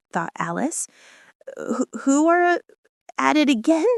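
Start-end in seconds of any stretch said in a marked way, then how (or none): 1.6-3.9 tentative asking. A narrator's reading. Alice venturing a question to the king, high and hesitant. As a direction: venture it timidly, tripping on the first word. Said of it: "she ventured to ask".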